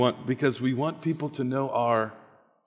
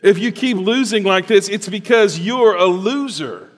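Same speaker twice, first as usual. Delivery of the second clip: mocking taunt; 9 semitones higher, 9 dB louder than usual. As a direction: jeer it out loud. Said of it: "from the serpent who says".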